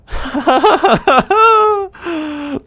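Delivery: high, loud staccato cackle; character cackle. Acted. Streamer laughs as the German character, high cackle. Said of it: "you know, the Heinrich voice".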